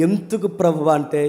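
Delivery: ordinary talk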